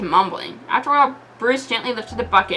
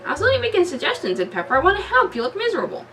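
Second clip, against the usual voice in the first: high Pepper voice; 8 semitones higher than usual. Acted.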